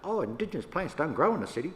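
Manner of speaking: a hammed-up dismissive boss; mocking the director. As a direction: send up the director's smug claim.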